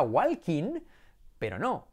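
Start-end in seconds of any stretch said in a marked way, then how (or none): 0.0-0.8 "walking" as WAL-KEEN, l sounded; pronunciación errónea. Shown as a demonstration. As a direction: pronounce every Spanish letter of "walking". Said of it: "En el peor de los casos". ("walking" mal dicho, «gualkin»)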